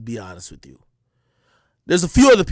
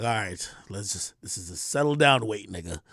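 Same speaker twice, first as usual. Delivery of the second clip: put-on low coaxing girlfriend; 10 semitones lower, 9 dB quieter than usual. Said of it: "They'd be like".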